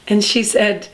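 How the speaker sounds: normal talking voice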